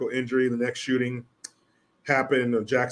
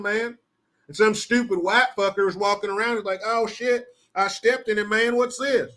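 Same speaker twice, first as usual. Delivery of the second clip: Southern cop voice; 8 semitones higher than usual. Acted.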